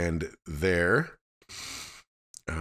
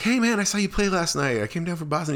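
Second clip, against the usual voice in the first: high, eager fan hello; re-enacted fan greeting, high-pitched; retold eager greeting; 11 semitones higher, 4 dB louder than usual. Greeting a musician in retold chat.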